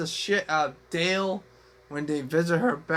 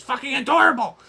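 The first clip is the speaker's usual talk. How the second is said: excited; 8 semitones higher, 7 dB louder, faster than usual. Real, not acted. Gushing over the cute kid.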